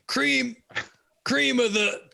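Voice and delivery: high wrestler impression, building in bursts; Macho Man Randy Savage. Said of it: "Macho Man Randy Savage Skyrim voice pack".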